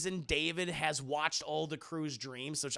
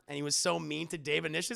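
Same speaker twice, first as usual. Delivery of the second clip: ordinary talk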